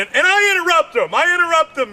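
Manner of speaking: normal talking voice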